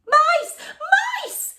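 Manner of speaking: high, loud shriek; maid's scream. Acted. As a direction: scream in alarm. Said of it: "she screamed".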